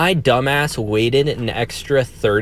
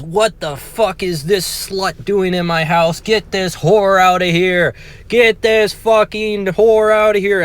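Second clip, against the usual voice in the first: mock furious mother, pitched high; angry screaming mom; 8 semitones higher than usual. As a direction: imitate an outraged mom yelling, climbing higher. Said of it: "turns all her anger and attention onto the girl".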